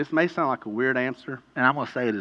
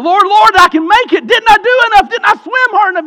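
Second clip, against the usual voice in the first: screaming, much higher and louder; screaming plea; 20 dB louder than usual. Acted. Drowning man screaming to the Lord for rescue. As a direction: cry out desperately to the Lord. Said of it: "if you keep screaming out".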